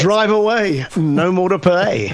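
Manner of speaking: high, punchy mock ad-announcer read; car ad slogan. Joking with the host, hammed-up car ad slogan. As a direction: sell it like a cheesy car commercial tag.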